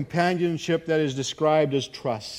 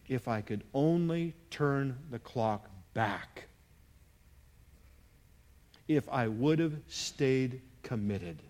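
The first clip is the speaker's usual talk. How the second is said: slow, weighted retold regret; tearful regret, retold; 4 dB quieter, slower than usual. Preacher quoting his repentant father, slow understated regret. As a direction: recount a father's regret, drawn out. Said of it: "with tears running down his face".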